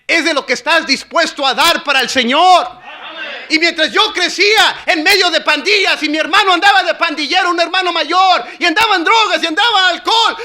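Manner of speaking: loud, high, impassioned sermon shouting; full-force preaching. Real, not acted. Preacher urging youth to give God everything, full-force preaching. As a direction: drive each phrase home at full voice.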